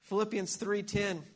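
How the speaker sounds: usual speaking voice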